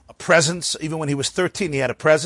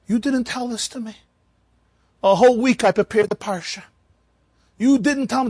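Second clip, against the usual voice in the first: put-on higher, aggrieved old man's protest; indignant old man; 7 semitones higher than usual. Old Moshe complaining to the gabbai, high and aggrieved. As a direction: whine it like a wronged old reader.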